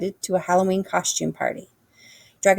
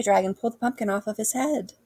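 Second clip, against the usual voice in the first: usual speaking voice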